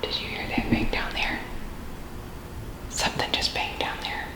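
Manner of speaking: real whisper, alert to a noise; hushed whisper. Real, not acted. Ghost hunter to team after a bang downstairs, whispering.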